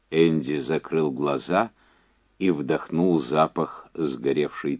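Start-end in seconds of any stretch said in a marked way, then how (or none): none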